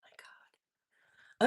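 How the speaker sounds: soft, voiceless gasp of awe; hushed, real disbelief; stunned whisper. Real, not acted. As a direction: whisper it to yourself in shock. Stunned by the devices.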